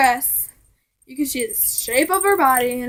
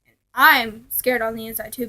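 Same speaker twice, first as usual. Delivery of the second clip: usual speaking voice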